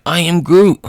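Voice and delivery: loud, high exclamation; excited character shout; acted alarmed cry. Groot alarmed in the fight.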